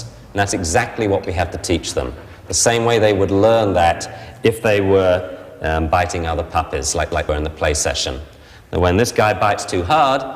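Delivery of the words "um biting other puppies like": usual speaking voice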